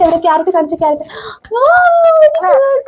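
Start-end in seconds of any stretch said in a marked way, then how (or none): 1.5-2.8 delighted praise. Real, not acted. Mother praising her toddler for finding things, delighted. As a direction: lift the praise up high.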